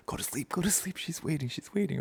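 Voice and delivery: high, hushed, pleading whisper; urgent whisper. Retold or imitated. Boy willing himself back into his dream, urgent whisper.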